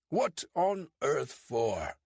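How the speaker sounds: David's put-on British accent, pitched high. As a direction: fake a polite British accent to fool a constable.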